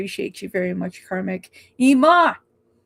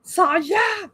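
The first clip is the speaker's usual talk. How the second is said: excited call; 10 semitones higher than usual. Real, not acted. Excitedly calling out a viewer.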